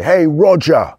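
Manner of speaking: put-on a small boy calling his brother in a strong British accent; child's British accent. Boy at school calling his brother, loud high British accent. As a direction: call out high and loud like a young British kid. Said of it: "'cause I used to say".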